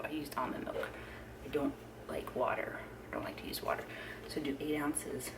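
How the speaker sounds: soft whisper. Real, not acted. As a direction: whisper so nobody wakes. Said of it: "I have to whisper".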